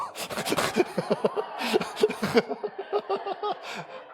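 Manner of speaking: long high laugh falling away. Real, not acted. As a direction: laugh at your own joke, high and long. Laughing at own joke. (높게 길게 웃음)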